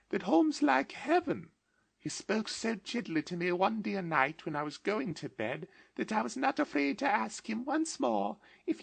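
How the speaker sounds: put-on very high, eager child's voice; a small sister bringing joyful news; little Fan full of glee; gleeful little girl. A narrator's reading. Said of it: "brimful of glee".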